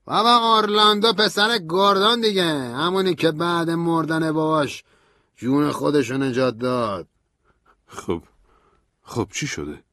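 high, loud, urgent voice fading at the end; Stanley agitated. A narrator's reading. Stanley tells narrator Orlando was killed, agitated then fading. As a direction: pour out the bad news.

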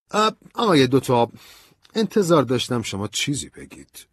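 high, loud colonel's voice; expectant colonel. A narrator's reading. Colonel expecting Ben to say something, high and emphatic.

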